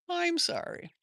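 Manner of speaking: "I'm sorry" in a gentle high whisper. Real, not acted. Comforting a hurt viewer.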